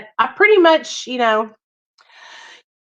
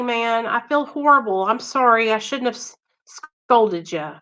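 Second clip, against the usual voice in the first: usual speaking voice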